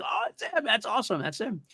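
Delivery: a light retold gasp of recognition; lightly imitating his own surprise at spotting the actor; recalled excitement.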